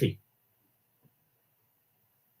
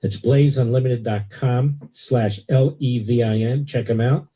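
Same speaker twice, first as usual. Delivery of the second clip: steady, lower-pitched sponsor read; 6 semitones lower than usual. Acted. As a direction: sell the magazine like a live radio spot. Host reads a magazine ad.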